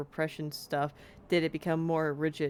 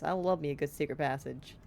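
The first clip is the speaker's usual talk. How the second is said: usual speaking voice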